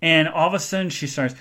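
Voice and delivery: usual speaking voice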